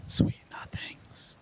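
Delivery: breathy whispered sign-off. Real, not acted. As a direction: whisper it slowly.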